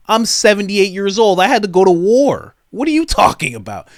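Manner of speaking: loud, high, mock outrage; indignant protest. Old veteran answers "you've had it good", indignant. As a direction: raise the voice as an affronted old veteran.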